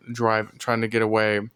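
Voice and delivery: ordinary delivery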